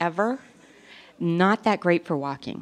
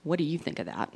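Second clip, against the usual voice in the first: flat echo; 2 semitones lower, 8 dB quieter than usual. Retold or imitated. Teen daughter echoing mom's question, flat and deadpan. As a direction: hand the question back unimpressed. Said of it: "She goes".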